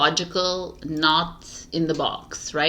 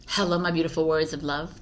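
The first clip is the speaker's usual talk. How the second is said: ordinary delivery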